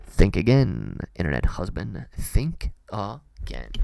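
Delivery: low, punchy playful challenge; mock warning. Streamer answers the internet husband's jibe, low mock warning. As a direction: drop the voice low, slowing on the last repeat.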